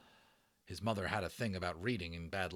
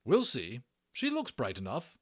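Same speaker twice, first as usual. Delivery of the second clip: brisk matron; 4 semitones higher, 6 dB louder than usual. Acted. Matron deciding.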